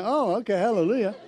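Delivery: high, loud mock-thrilled reaction; delighted hallelujah. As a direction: exclaim it like sudden good news has landed. Acted reaction to hearing about check.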